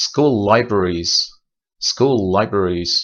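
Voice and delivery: school libraries slowly, one long held l; geminated school libraries. Shown as a demonstration. Teacher modelling a long l in school libraries. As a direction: stretch the l between school and libraries. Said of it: "چند تا مثال بزنیم".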